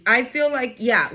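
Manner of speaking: ordinary talk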